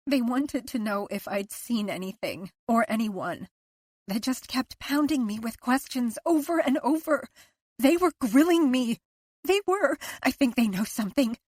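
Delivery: rising panic about police; agitated fear. A narrator's reading. Liz to Owen about police questions, rising panic.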